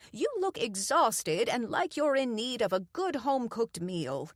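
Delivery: a hint of a doting old woman; fussing old lady. A narrator's reading. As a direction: fuss over her like a grandmother. Doreen fusses.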